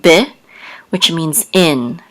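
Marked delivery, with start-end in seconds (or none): 0.0-0.3 "be" with a short eh vowel; quick eh sound. Shown as a demonstration. Teacher showing the shava's sound, a quick eh in be. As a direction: say "be" quickly, as beh. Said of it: "will make a quick eh sound".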